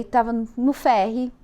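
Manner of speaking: ordinary delivery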